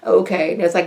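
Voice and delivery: ordinary talk, nothing marked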